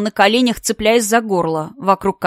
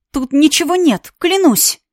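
higher, pleading and scared; frightened pleading; 6 semitones higher than usual. Acted. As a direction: beg him to believe you.

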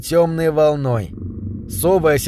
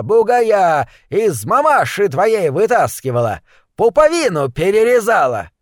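high, drawn-out indignant granny; high-pitched old midwife's tirade; scolding old woman; 6 semitones higher, slower than usual. A narrator's reading. Midwife scolds lad who accused her.

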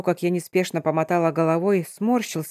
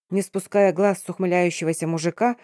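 plain reading voice

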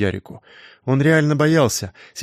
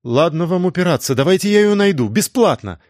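eager; 6 semitones higher than usual. A narrator's reading. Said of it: "горячо произнесла юная сыщица".

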